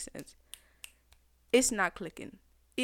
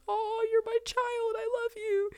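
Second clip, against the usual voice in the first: mock father voice; 14 semitones higher than usual. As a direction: play the doting dad, lightly hammed. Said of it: "And he's like".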